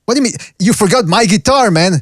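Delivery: acted skit line. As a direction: play the wronged customer, slowing on guitar. Host acting out skit line.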